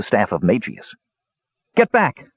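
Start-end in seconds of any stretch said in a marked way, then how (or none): 1.8-2.3 high-pitched warning cry; sharp cry. A narrator's reading. Young mage guarding his staff from a creature, sharp cry. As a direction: cry out to ward it off. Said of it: "Palin cried".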